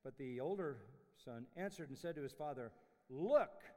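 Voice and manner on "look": rude look. Retold or imitated. Older son brushing off his pleading father, curt. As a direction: snap it at him with no respect. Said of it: "Is that what you say to your father?".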